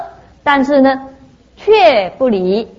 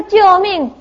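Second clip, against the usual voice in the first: higher-pitched plea for rescue; mock cry for help; 8 semitones higher, 3 dB louder than usual. Mimicking beings in hell begging for rescue, mock cry. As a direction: imitate someone crying out for help.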